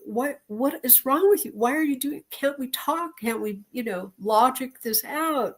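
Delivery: exasperated pleading wife. Retold or imitated. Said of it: "And I would be like".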